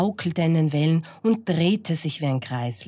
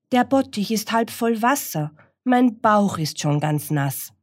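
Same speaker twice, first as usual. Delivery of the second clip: complaining pig; 6 semitones higher than usual. Acted. Pig character complains in tub.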